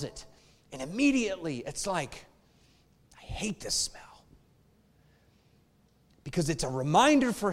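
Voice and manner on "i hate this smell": a hushed, breathy whisper of disgust; whispered thought. Acted. [name] whispering his dread at the smell. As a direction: whisper it like a private groan.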